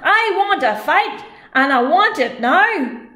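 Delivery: slow, loud giant voice; giant's loud demand. Acted. Scottish giant demands a fight, loud.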